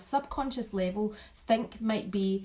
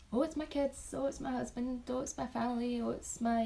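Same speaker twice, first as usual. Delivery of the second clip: lightly voiced stock replies, higher than usual; a higher, slightly softer mock-answer voice; mimicked easy answers; 4 semitones higher, 4 dB quieter than usual. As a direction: imitate people reeling off obvious answers. Voicing people's typical first answers.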